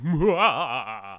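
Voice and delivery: loud hammy vampire laugh; vampire mwah. Retold or imitated. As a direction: belt out a cartoon vampire cackle. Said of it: "some guy be like".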